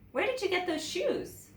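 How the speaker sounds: put-on a nosy little girl asking; curious child. A narrator's reading. Penny questions Suki.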